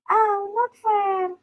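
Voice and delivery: high, drawn-out whine; whining. A narrator's reading. Luffy losing the argument, long high whine. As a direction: complain slowly in a high voice. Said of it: "Luffy whined".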